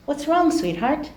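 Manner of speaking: soft, hushed mother asking gently; tender concern. Acted. Mother gently asking her tearful daughter, soft and tender. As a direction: soothe a crying child.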